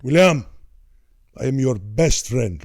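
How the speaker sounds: imitating a solemn accented father; old man impression. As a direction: call the name, then declare it like an Italian dad. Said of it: "like this".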